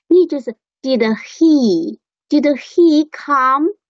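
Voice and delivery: normal talking voice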